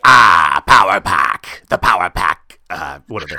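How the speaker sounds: loud, low creature voice; Snark voice. Shown as a demonstration. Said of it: "we gave them a voice".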